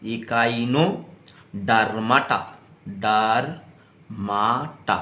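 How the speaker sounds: इकाइनोडर्माटा drawn out slowly, then डर्माटा again; slow syllables. Shown as a demonstration. Teacher shows students how to pronounce it, slow syllables. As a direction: split इकाइनोडर्माटा into slow parts. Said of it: "इस तरह से pronounce करिएगा".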